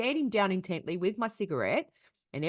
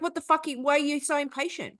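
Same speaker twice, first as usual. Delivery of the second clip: rude stranger; 7 semitones higher than usual. Retold or imitated. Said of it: "he was just like".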